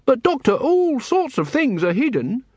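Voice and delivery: high-pitched, querying objection; anxious-to-clarify underling, pitched high; protesting junior lecturer. Acted.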